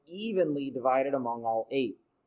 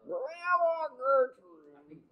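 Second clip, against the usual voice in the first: put-on high, slow old grandma voice; creaky aged granny voice; 11 semitones higher, much slower than usual. Shown as a demonstration. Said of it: "old person voice".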